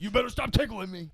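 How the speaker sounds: high, loud mock protest; being tickled. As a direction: giggly fake warning. Comic playing tickled coworker.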